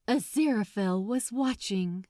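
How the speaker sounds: ordinary delivery, nothing marked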